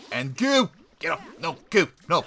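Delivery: high, loud panicked yells; startled shouting. Real, not acted. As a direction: yell at the slime to get off. Attacked by slime in game.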